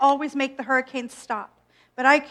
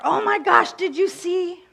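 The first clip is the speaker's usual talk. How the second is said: much higher, louder gasping gush; mock gossip excitement; 6 semitones higher, 5 dB louder than usual. Imitating gossip between friends, high excited gush. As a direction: squeal it like juicy news.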